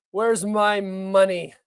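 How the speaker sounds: drawn-out, lightly voiced demand; slow mock plea. Streamer repeats the Zelensky money line slowly as a bit. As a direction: stretch it out slowly, mock pleading. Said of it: "I'm not very good at impressions".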